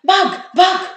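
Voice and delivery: sudden high, loud alarm cry; shouting. A narrator's reading. Habul cries out in the dark car, high and loud. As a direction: yell a warning about the tiger. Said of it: "হঠাৎ হাবুল চেঁচিয়ে উঠল".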